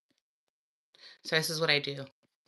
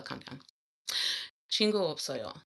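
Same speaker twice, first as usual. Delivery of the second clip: ordinary talk, nothing marked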